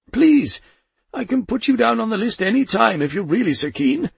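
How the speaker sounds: high, loud pleading; pleading Death. A narrator's reading. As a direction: Death begging Poldy to let go, high pleading. Death pleading while shaken.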